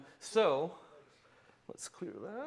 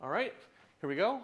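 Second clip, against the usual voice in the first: ordinary talk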